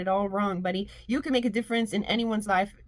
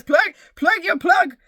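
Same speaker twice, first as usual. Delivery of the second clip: playful voice; 7 semitones higher, 7 dB louder, slower than usual. Pretend. Mock voice, slow far.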